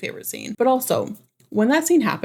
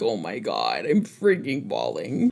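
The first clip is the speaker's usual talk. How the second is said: a much lower, softer, slower blubbering voice; hammed-up crying voice, pitched way down; mock blubbering; 11 semitones lower, 4 dB quieter, slower than usual. Retelling how she wept at Sam's sacrifice, mock blubbering. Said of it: "I was like".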